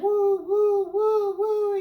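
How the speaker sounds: high, loud woo-hoo cries of joy on a ride; gleeful whooping. Retold or imitated. Mourner voicing the doomed Steuben on the tilt-a-whirl, high whoops. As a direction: whoop like a kid on a spinning ride. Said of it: "He was like".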